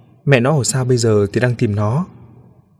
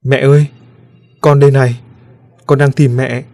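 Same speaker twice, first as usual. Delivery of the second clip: put-on child calling out louder, mildly tearful; choked up; 3 semitones higher, 5 dB louder than usual. A narrator's reading. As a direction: call for mother with a touch of a lump in the throat. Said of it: "Tiếng đứa bé nghẹn ngào". (đứa bé gọi mẹ, hơi nghẹn ngào)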